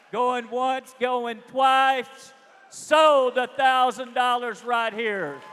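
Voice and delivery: loud, building to sold; auctioneer shout. Real, not acted. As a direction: call it out big and final. Closing the auction sale.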